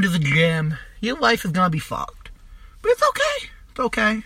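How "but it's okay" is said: sudden high sing-song reassurance; mock reassuring. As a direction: chirp it high, like comforting a child. Mock reassurance after bleak prediction.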